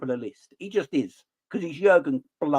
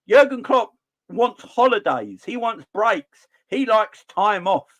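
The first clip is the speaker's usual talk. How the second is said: exasperated; 7 semitones higher, 4 dB louder, slower than usual. Real, not acted. Emphatic slow exasperation.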